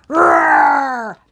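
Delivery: loud, open-throated dinosaur roar. Shown as a demonstration. Host shows the children a dinosaur roar, loud and hammed-up. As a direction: roar like a big dinosaur for the kids. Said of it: "Can you make a roaring sound like a dinosaur?".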